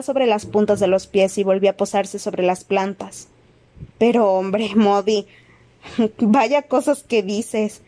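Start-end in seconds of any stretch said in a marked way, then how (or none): 4.0-7.8 mild, drawn-out protest with an uneasy laugh; nervous protest. A narrator's reading. Nervous official protesting to Moody, uneasy mild protest. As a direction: laugh it off nervously, stretching the words. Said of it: "protestó".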